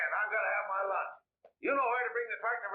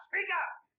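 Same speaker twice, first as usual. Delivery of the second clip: shouted demand; 9 semitones higher than usual. Acted. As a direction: shout it across the room. Repeated louder demand.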